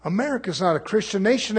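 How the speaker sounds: usual speaking voice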